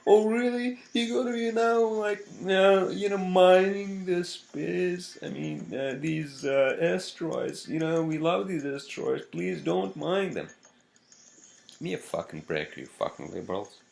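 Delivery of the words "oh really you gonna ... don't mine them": high, loud, whiny mock of a liberal. A ranting vlogger mocks liberals complaining about asteroid mining, high whiny voice. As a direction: parody a preachy liberal, way up high. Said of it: "people will be like".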